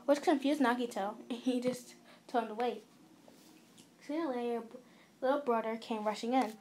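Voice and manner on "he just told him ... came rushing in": ordinary delivery, nothing marked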